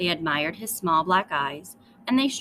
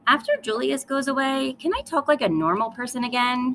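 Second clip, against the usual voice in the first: put-on raised, higher-pitched child's shout; 5 semitones higher, 4 dB louder than usual. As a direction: call out in frustration. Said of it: "she shouted".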